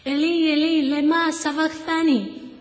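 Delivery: Jesus's anguished cry in Hebrew, drawn out. Acted. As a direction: call out the words slowly, as from the cross. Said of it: "十字架上での叫び".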